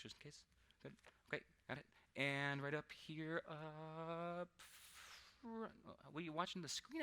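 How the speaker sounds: ordinary talk, nothing marked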